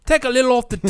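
put-on a botched Scottish accent, high and loud; bad Scottish accent. Host fakes a Scottish customer's haircut order, bad Scottish accent. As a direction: fake Scottish customer in a barber's chair. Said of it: "That was terrible".

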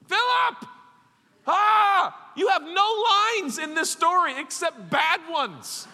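high, loud comic scolding; mock exasperated outburst. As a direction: explode at the hapless disciple. Voices Jesus snapping at Philip.

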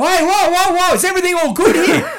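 high, loud "whoa, whoa", laughing through it; mock alarm, breaking it up; intervening workmate. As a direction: workmates rushing over to calm him, loud and high.

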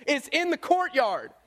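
normal talking voice

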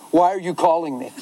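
ordinary talk, nothing marked

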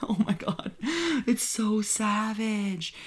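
laughing, high and slow; delighted laugh at the gore. Real, not acted. Reacting to the blender kill, laughing high.

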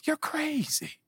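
high, dismissive jeer; a high scoffing put-down; mocking doubter. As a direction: sneer it like the voice of doubt. Doubter voice again.

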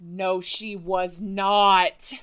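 loud, slow, drawn-out disbelief; stunned disbelief. Real, not acted. Friend reacting to a celebrity sighting, stunned disbelief.

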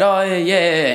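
high-pitched, over-agreeable yeah; quick reassurance. Retold or imitated. Passenger telling the driver he's okay, high-pitched reassurance. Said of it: "And I said".

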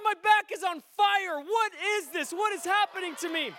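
high, loud, acted alarm; astonished outburst. Swearing man as teen prays for him, loud astonished cries. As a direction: cry out as a man startled by his own body.

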